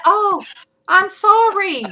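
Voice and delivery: high, loud exaggerated sorry; playful, over-the-top apologizing; mock apology. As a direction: plead it in a hammed-up high voice. Mock apology to son's correction.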